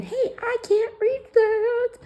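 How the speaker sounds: high, slow squeaky plea; squeaky helpless voice. Retold or imitated. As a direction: squeak it out like someone small. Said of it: "I don't like asking".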